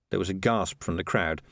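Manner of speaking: ordinary delivery, nothing marked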